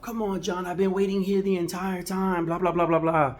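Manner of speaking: retold angry customer, pitch way up; mouthing off. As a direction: nag like a fed-up customer in the chair. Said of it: "the guy was still mouthing off".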